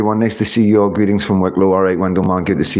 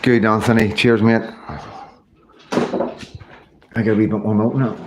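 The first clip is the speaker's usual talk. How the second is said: normal talking voice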